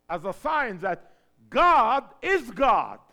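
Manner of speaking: slow and loud, hammering each word; preaching emphasis. Acted. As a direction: land the point hard. Preaching climax.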